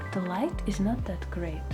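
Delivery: ordinary talk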